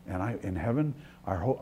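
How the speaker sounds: ordinary talk, nothing marked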